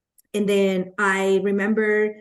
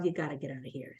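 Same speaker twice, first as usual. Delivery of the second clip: much softer and lower, under the breath; quiet self-talk; 7 semitones lower, 14 dB quieter, much faster than usual. Retold or imitated. Presenter to herself after no one signs up, soft and low. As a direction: mutter it to yourself. Telling herself to leave meeting.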